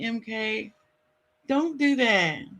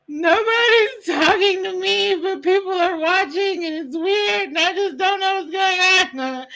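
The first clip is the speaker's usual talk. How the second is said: higher, whining, as if near tears; mock whiny complaint; 8 semitones higher than usual. Streamer mocking her earlier lonely self, high fake whine. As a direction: act out feeling ignored on stage, half crying. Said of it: "I was up here like".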